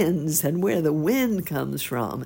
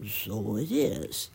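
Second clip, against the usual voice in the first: slow, lower, hesitant agreement; worried sparrow; 4 semitones lower, slower than usual. A narrator's reading. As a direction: drag it out, a little worried. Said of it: "said the very worried sparrow".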